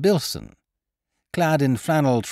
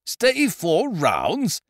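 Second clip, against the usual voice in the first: slow, higher outraged echo; affronted exclamation, pitched up and slowed; indignant cry; 7 semitones higher, much slower than usual. Acted. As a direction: scoff at the very doubt, drawn out slowly. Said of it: "cried Ukridge".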